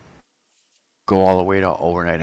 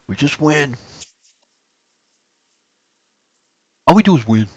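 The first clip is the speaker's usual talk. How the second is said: lightly voiced Trump, higher; a hint of boastful Trump; Trump impression; 7 semitones higher than usual. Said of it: "I can't really do a Trump impression".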